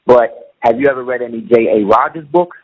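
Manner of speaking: ordinary talk, nothing marked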